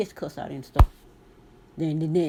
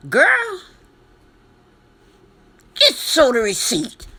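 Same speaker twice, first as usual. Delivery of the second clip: emphatic advice; 5 semitones higher, 7 dB louder, slower than usual. Real, not acted. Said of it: "advising viewer emphatically".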